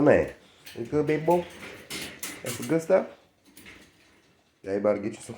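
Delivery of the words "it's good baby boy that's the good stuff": sweet pet talk, pitched high. Real, not acted. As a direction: coo up high like talking to a puppy. Baby talk to the dog.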